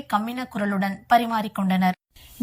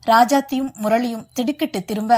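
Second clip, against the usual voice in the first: usual speaking voice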